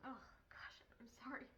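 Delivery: soft whispered sorry, choking up; tearful whisper. Real, not acted. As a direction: whisper it, on the edge of crying. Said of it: "I'm really trying not to cry".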